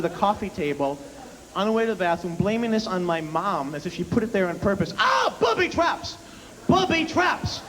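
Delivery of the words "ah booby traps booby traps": high, slow panicked shout of a tripped dad; hammed-up dad crying booby traps; mock yell. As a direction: yell it like you've just stubbed your shin in the dark. Dad tripping over coffee table.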